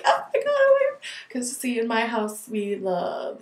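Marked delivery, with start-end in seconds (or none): 0.0-1.1 very high, fast, frantic repeat; freaking out. Retold or imitated. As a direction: squeal it in a rush. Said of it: "I was like".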